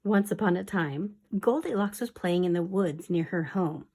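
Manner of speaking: soft, hushed storytelling whisper; whispered story reading. Acted. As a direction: read the tale in a secret whisper.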